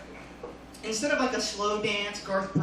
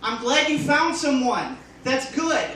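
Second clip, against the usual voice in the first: loud mock-cheery congratulations; sarcastic, overdone happy-for-you; 5 semitones higher, 5 dB louder than usual. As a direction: fake enthusiasm, laying it on. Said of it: "back then I was sarcastic".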